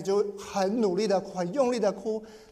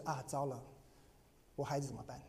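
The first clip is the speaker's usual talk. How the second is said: soft worried aside; 5 semitones lower, 13 dB quieter, faster than usual. Retold or imitated. Retold inner worry.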